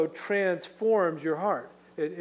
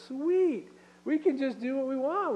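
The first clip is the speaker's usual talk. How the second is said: mock carefree glee; 8 semitones higher than usual. Mocking those who abuse grace.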